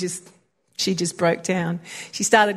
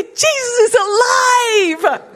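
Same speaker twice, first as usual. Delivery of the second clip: high, slow, ringing shout; joyful proclamation; 13 semitones higher, 3 dB louder, much slower than usual. Retold or imitated. Friend announcing her faith to coworkers, high joyful shout. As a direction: cry it out like the best news ever. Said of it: "I got them all together and I said".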